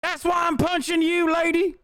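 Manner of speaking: very high, slow parody voice; mock the attacker in a squeaky drawl; mocking hypocrite. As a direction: squeal it like a self-righteous bully. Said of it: "That's what they're doing".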